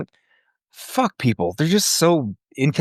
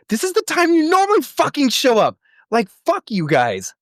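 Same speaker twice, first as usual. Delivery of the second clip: mock outrage. Retold angry thought at vet.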